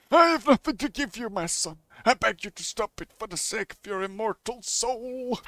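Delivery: high-pitched pleading clergyman; hammed-up priestly appeal; begging priest. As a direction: beg as a pious pastor.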